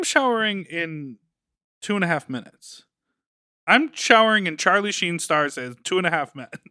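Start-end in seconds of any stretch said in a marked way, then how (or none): none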